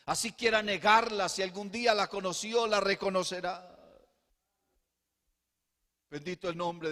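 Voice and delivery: loud, high-pitched impassioned sermon; full-force pulpit fervor; fervent preaching. Acted. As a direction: preach it out to the whole church.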